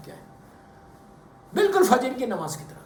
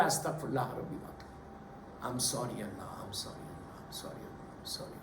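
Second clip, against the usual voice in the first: very low, soft voice fading to a whisper; hushed repentant plea; 13 semitones lower, 17 dB quieter than usual. Acted. Preacher models asking Allah's forgiveness, hushed and penitent. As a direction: murmur it like a private apology to God.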